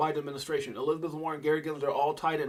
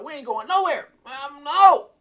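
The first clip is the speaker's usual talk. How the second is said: a light, higher voice for the wife; imitating wife; 11 semitones higher, 11 dB louder than usual. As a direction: mimic her refusing the trip. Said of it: "She's like".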